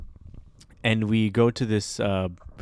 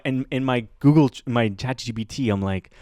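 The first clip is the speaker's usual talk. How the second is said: ordinary talk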